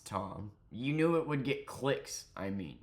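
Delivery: ordinary talk, nothing marked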